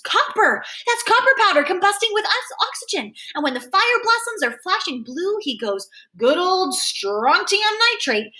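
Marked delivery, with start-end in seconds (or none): 0.0-3.1 high, thrilled cry, not full volume; excited scream. A narrator's reading. Freak tugs his friend's hair, excited high scream. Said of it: "Freak tugs my hair and screams". 6.2-8.3 high, slow, delighted crowing; shouting out. A narrator's reading. Freak greets blue fire blossoms, excited calling. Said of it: "Freak keeps on shouting out the names".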